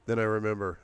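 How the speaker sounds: ordinary delivery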